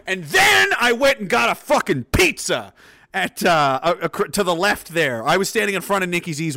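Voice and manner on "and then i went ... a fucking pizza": loud, raised-pitch declaration; exuberant punchline. Real, not acted. Capping the fight story with pizza, exuberant shout. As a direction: belt out the absurd next step.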